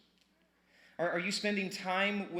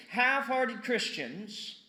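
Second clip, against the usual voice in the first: "Half-hearted Christians" said loud, higher and slow; loud, slow emphasis; 7 semitones higher, 5 dB louder, much slower than usual. Shown as a demonstration. Repeating Max Lucado's line to the church, loud and slow. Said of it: "You hear him?".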